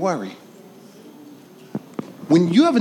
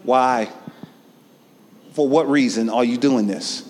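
God's voice; 7 semitones lower than usual. Retold or imitated. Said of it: "God is sitting there saying".

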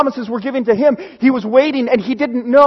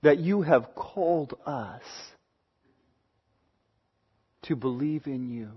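much softer and lower, reverent prayer voice; closing prayer; 10 semitones lower, 12 dB quieter than usual. Real, not acted. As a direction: pray quietly before God. Pastor leads closing prayer.

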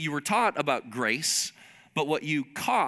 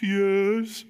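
imitating a crying kid, drawn out and higher; hammed-up tearful plea; mock tearful child; 5 semitones higher, 3 dB louder than usual. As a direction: draw it out like a sobbing five-year-old. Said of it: "you just tears and sobbing".

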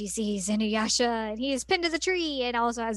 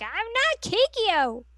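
much higher, slow, indignant protest; mock protest; 10 semitones higher, 4 dB louder, slower than usual. Imitating Kagome, high slow protest at Inuyasha. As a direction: drag it out like an annoyed teen. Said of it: "her being like".

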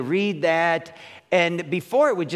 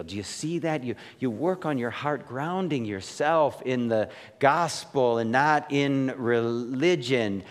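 usual speaking voice

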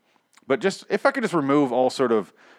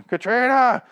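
much higher, loud mock call; 14 semitones higher, 5 dB louder, slower than usual. Paul searching the building for his wife, loud high call. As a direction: call her name across the building. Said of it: "like, going".

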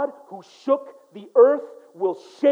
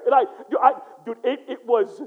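usual speaking voice

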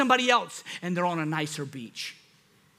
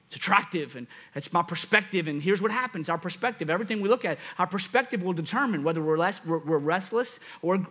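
ordinary talk, nothing marked

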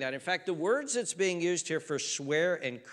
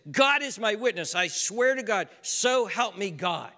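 louder, higher, earnest swearing; a desperate disciple's vow, pitched up and loud; fervent oath; 6 semitones higher, 6 dB louder than usual. Retold or imitated. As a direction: call God as witness, loud and high. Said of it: "He's saying something like this".

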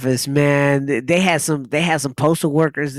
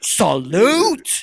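high, drawn-out military cry; mock salute; 7 semitones higher than usual. Podcaster jokingly saluting the postal workers, mock-military. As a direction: call it out like a parade-ground salute.

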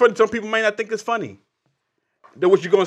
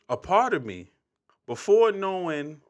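ordinary delivery

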